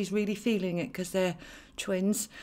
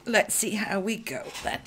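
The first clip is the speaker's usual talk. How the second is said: ordinary talk, nothing marked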